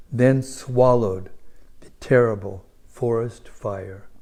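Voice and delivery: scripture reading. Acted. Preacher reading the verse aloud to the congregation, solemn. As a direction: proclaim the holy text to the whole hall.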